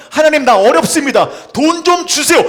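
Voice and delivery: loud, high plea for help; loud plea to God. Pretend. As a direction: cry out to God for money. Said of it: "표현하세요". (하나님께 크게 외치는 간구)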